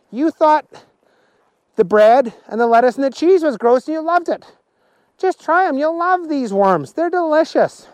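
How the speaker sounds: high, insistent character voice; high-voiced keen persuasion; eager Willie, coaxing. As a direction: coax them to taste it, pitched well up. Willie urges them to try.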